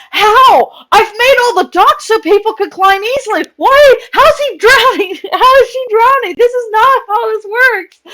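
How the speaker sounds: high, loud, incredulous questions; baffled. Retold or imitated. Streamer retelling disbelief at friend drowning, high and loud.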